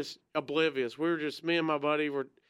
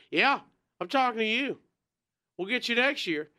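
retold heckler yell; 6 semitones higher than usual. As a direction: shout it at the smoker in the crowd. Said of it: "who's yelling?".

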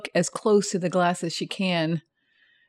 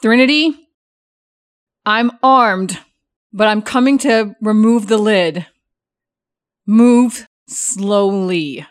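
raised, commanding voice; firm loud warning; 5 dB louder, slower than usual. Acted. Character warns coffin occupant firmly.

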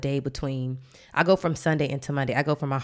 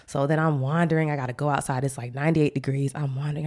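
usual speaking voice

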